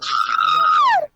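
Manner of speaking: huge high yell, far above the normal voice; mock shout. Friends reading fanfic, Tails yells in protest. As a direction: blast it out in a shrill fake protest.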